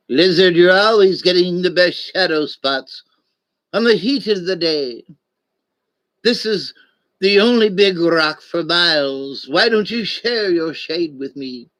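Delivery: sly puppet-snake voice. Acted. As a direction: play the snake from the old puppet show. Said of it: "The voice I'm doing is the voice of the snake".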